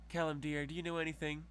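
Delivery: mock-sweet noblewoman coaxing her dim husband; condescending lady. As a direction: talk down to him like a pet. Diana condescending to Callum.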